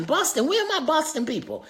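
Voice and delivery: high excited shout-out; shout-out to the Boston fans; calling out. Real, not acted. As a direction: hype up the Boston crowd.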